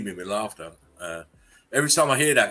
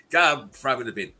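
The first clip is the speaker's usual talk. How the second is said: usual speaking voice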